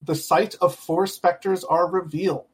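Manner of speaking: slow, hushed reveal narration. A narrator's reading. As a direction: draw out the reveal quietly.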